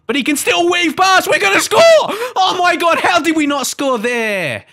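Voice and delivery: high yell building into disbelief; excited shouting. Real, not acted. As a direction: shout the attack on, then wail at the miss. Chance builds, then is missed.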